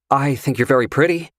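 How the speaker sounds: nervous schoolboy compliment. Acted. Flustered Carter compliments the stranger, like a schoolboy. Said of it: "sounding way more like a schoolboy".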